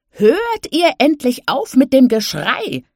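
a high, loud, slow yell through the window; old granny yelling in from the porch; bellowing granny. A narrator's reading. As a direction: shout it out, fed up with the noise. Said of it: "brüllte Granny".

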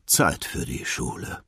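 weary father just waking; sleepy resignation. Acted. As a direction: say it with a drowsy sigh. Sleepy father gives in and gets up. (verschlafen und ergeben)

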